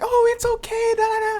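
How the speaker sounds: very high mock-sweet falsetto; sweet teacher voice. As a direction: coo it like a gullible teacher. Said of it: "They be like".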